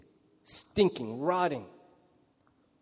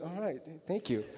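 awkward quiet thanks; 2 semitones lower, 9 dB quieter than usual. Retold or imitated. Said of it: "I'm like".

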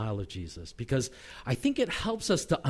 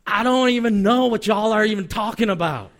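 hammed-up Southern protest; Southern accent; 4 semitones higher, 6 dB louder than usual. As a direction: play an indignant Southerner denying it. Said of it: "coming from the South".

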